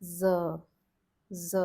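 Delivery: "Z" repeated clearly; Z sound demo. Shown as a demonstration. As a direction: model "Z" for students. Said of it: "it becomes".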